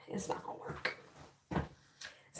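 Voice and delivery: barely audible whisper; hopeless whisper to herself; defeated whisper. Real, not acted. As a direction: breathe it out, defeated. Giving up on hair.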